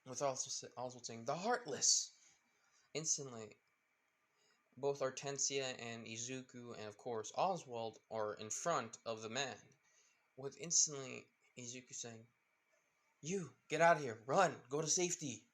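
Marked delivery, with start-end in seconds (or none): 1.2-2.1 raised, louder story exclamation; alarmed call. A narrator's reading. Character calls out at sighted monsters. 13.2-15.4 raised, loud order to flee; urgent command. A narrator's reading. Hero urges man to flee danger.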